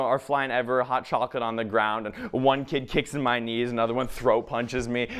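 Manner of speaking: normal talking voice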